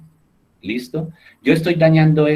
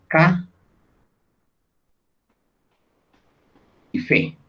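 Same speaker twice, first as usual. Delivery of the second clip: ca and fe said as separate beats, ca raised; syllables split apart; 6 semitones higher, slower than usual. Shown as a demonstration. Teacher splitting café into syllables for students. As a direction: break café into ca and fe for the class. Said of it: "tenemos dos sílabas".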